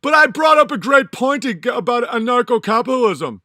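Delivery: high, loud, whiny mock of a commenter. Mocking a demanding viewer who wants replies, in a high loud whine. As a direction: shout it like a needy nitpicking viewer. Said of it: "people are like".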